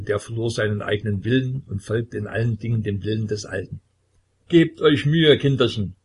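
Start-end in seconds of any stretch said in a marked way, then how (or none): 4.5-5.9 put-on high, loud and jokey old man; joking. A narrator's reading. Old man jokes with those about to die, high and loud. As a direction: tease the doomed children, loudly. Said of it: "scherzte er".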